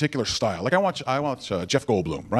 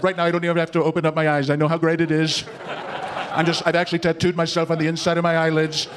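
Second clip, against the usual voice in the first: boastful politician in an even monotone; level, self-satisfied bragging impression; Donald Trump. Comic mimicking a politician's superlatives for the crowd, boastful monotone. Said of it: "I've always loved watching Donald Trump".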